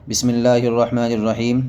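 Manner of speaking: usual speaking voice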